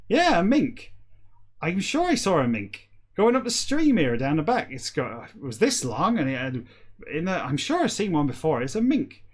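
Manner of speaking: West Country farmer with high-pitched bursts. Retold or imitated. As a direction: imitate a West Country farmer insisting on his story. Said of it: "Like a farmer".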